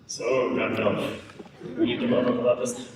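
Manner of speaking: terrible mock-grand movie voice; Christopher Lee. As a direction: drop low and draw it out, hammed-up. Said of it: "that's a terrible impression of Christopher Lee".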